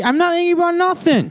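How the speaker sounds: very high, squeaky denial; high-pitched mock anger. Accused of being angry by his co-host, high-pitched denial. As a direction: protest far up in pitch. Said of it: "your voice gets high pitched".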